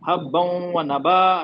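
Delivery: हब्बन व नबा drawn out very slowly; teacher reciting हब्बन व नबा for the class; slow Quran recitation. Shown as a demonstration. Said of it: "इसको क्या पढ़े".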